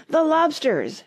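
high, loud interjection; shout. A narrator's reading. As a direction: burst in with a high-pitched shout. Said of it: "shouted the Gryphon".

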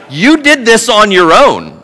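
loud, high, exasperated telling-off; mock-exasperated scolding, pushed up and loud; exasperated God. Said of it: "but he's like".

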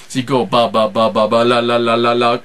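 cheek-pocket babbling; duck noises. Shown as a demonstration. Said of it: "make some noises".

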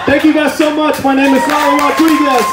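high, bright host hand-off; announcer sign-off. Real, not acted. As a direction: hype up the next act. Comic signs off, introduces host.

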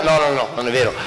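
normal talking voice

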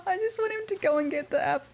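doting squeaky voice for a cute pet; cutesy high coo. Real, not acted. Cooing over cute in-game pet.

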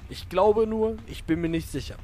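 normal talking voice

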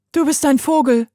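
höher und lauter, kindlich direkt; direktes kleines Mädchen. A narrator's reading. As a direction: sag es ihm frei heraus als Kind. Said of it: "brachte es Heidi ohne Umschweife auf den Punkt". (Mädchen, hoch und geradeheraus)

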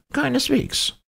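very high, curt professor; professor contradicting. A narrator's reading. Professor contradicting the captain, high voice. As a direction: deny it in a high character voice.

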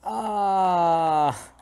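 long, high falling wail; mock wail. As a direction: let out a drawn-out "aah". Drawn-out cry during game.

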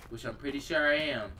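ordinary delivery

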